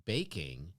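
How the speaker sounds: "baking" with a distinct -ing ending; clearly said "baking". Shown as a demonstration. Insisting on what was asked for, "baking" said clearly. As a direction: enunciate both syllables of "baking". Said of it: "When I clearly said".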